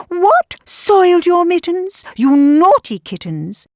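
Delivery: lightly scolding, high rising questions; a touch of motherly shock; mild telling-off of naughty little ones; scolding mother cat. A narrator's reading. Mother cat scolds her kittens.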